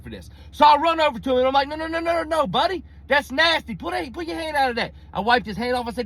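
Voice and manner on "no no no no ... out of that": put-on high, urgent no no no at a child; alarmed high pleading at the kid; panicked scolding. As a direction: rush in frantic to stop him. Panicked telling kid to stop.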